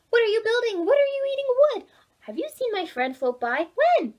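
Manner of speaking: high, loud, fast questions; eager young Oliver. Acted. Oliver questioning the beavers, high and loud. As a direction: quiz the beavers excitedly.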